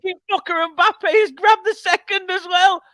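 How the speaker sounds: furious disbelief at the goal; angry outburst. Real, not acted. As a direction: spit out the curse at the scorer. Cursing the equaliser.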